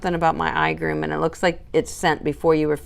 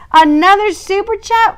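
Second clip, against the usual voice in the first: much higher and louder, delighted disbelief; surprised; 12 semitones higher, 8 dB louder than usual. Real, not acted. Streamer reacting to a viewer's surprise super chat, surprised. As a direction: squeal it like the gift caught you off guard.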